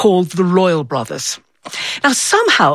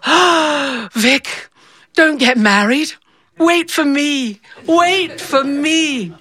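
put-on higher, slow, yearning little girl's plea; lovesick girl pleading; 6 semitones higher, much slower than usual. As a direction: beg him to wait, drawn out and longing. Ten-year-old pleads to her crush.

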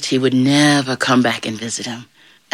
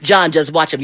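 ordinary delivery, nothing marked

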